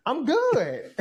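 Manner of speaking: high, laughing "I'm good". Real, not acted. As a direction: laugh it out, amused at yourself. Laughing at his younger self.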